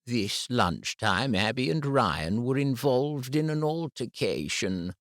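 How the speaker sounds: a calm, careful head teacher; composed school head breaking bad news. A narrator's reading. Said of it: "she said".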